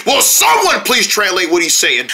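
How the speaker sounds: ordinary talk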